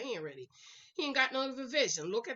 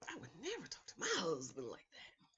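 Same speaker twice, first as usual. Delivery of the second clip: prissy disapproval; 4 semitones lower, 8 dB quieter than usual. Retold or imitated. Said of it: "They're like".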